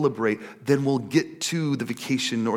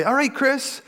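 lightly voiced coach, higher pitched; coach's chipper prompt; 6 semitones higher than usual. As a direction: imitate an upbeat coach opening a session, higher. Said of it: "she would say".

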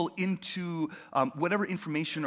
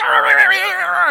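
high shrill burst of laughter; 13 semitones higher, 14 dB louder than usual. Pretend. Mock burst after arguing in car.

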